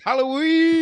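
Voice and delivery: high, loud celebratory holler; gleeful shout. Pretend. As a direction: belt it out like a party cheer. Shouting the holiday with glee.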